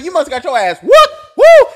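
teasing a buddy, rising high into a whooping woo; mocking friend. Friend clowning on the guy who lost the fight, high mock whoop.

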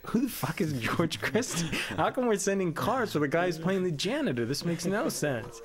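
exasperated outburst. Retold or imitated. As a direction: blow up at the crew in disbelief.

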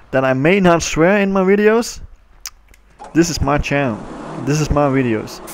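ordinary talk, nothing marked

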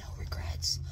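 hushed ASMR whisper. Real, not acted. To the camera, a secret ASMR whisper. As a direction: breathe the words, no voice. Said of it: "A S M R".

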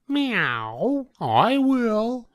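high eager meowing cat. A narrator's reading. Cat eagerly volunteering to eat, high voice. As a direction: mew then jump at the offer. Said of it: "said the cat".

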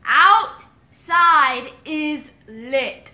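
drawn-out word by word; slowed down. Real, not acted. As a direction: space out OUTSIDE, IS and LIT. Slowing phrase for lip-reading partner.

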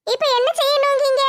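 put-on a stubborn girl holding her ground; young woman Nimmi. Nimmi demanding.

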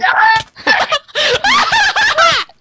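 high, loud burst of laughter; loud cackle. Real, not acted. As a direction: cackle loudly at high pitch. Cackling while killing in game.

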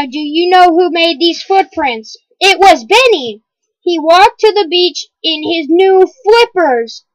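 ordinary delivery

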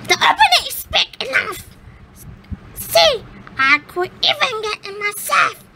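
very high, fast scolding witch. Acted. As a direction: snap at the girl impatiently. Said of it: "said she".

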